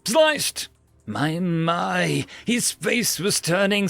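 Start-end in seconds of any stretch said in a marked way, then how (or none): 0.1-0.7 snapped. Acted. Fed-up customer snaps answer.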